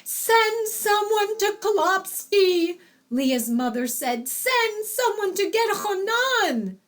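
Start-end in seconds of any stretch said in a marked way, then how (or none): 0.0-2.8 high, slow urgent call; urgent mother calling. A narrator's reading. Mother urgently calls for help. 4.3-6.8 high-pitched urgent call; urgent mother calling. A narrator's reading. Bride's mother sends for the young man, calling urgently.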